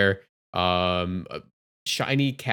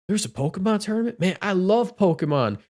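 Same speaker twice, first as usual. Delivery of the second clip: retold excitement; 8 semitones higher than usual. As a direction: imitate a thrilled Pokemon fan. Retold stranger thrilled about tournament.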